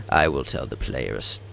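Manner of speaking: wrong character voice. Acted. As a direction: slip into the deep second entity. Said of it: "I probably mixed up the voices".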